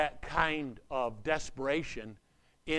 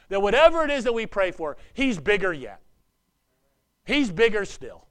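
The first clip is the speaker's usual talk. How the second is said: loud, high, fast preaching burst; preaching shout; 6 semitones higher, 8 dB louder than usual. Real, not acted. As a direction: lift it into a full shout of praise.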